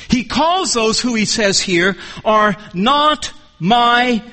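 slow, pounding preacher's emphasis on calls; preaching at full force; emphatic preaching. Acted. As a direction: drive each "He calls" home from the pulpit.

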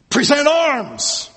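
barked drill order, high and slow; military command. Retold or imitated. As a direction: call it out like a drill sergeant. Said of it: "I was thinking".

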